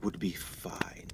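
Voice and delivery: secretive whisper. Acted. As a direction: keep your voice down from the shadows. Mysterious visitor in the dark.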